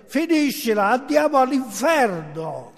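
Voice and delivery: high, exasperated snap; irritated outburst. Acted. Fed-up fellow passenger silencing the screamer, irritated outburst. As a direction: cut him off, loud-edged and fed up. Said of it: "towarzysz podróży zdenerwował się".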